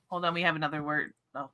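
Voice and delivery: ordinary delivery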